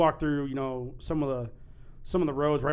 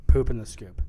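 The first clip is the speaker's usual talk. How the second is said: chest voice; 8 dB louder than usual. Pretend. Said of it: "Say it with your chest".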